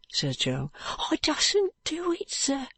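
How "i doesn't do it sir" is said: put-on high, slow, frightened boy's voice; a scared boy who won't give the name; fearful street boy. Joe refuses, pleading.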